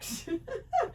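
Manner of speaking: a short, soft self-amused laugh. Real, not acted. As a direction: laugh at yourself softly. Laughing at her own repetition.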